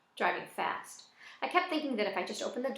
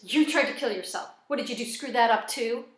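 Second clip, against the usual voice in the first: louder sneering jab; mocking taunt; 2 semitones higher, 7 dB louder than usual. Joey taunting Zach about his failed attempt, mocking and louder. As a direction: jeer at him like a cruel kid brother.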